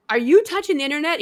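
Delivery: high fast mock yell; retold yell. Mother yelling at her teenage son, mock yell. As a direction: snap a quick question at the teenager. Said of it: "I just yelled at my fourteen-year-old".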